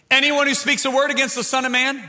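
loud, high scripture proclamation; full-force preaching. Real, not acted. Preacher reading the words of Jesus, full-force preaching. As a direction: declaim the verse from the pulpit.

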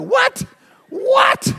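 squeaky stunned double-take; shocked what. Retold or imitated. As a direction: blurt it out in sheer disbelief. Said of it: "You're like".

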